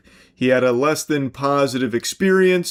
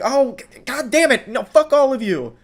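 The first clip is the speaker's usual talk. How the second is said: high, louder mocking rant; mock furious outburst; 10 semitones higher, 5 dB louder than usual. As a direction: spit it out like an enraged Karen. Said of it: "she's like".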